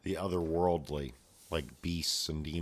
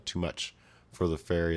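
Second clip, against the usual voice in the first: ordinary delivery, nothing marked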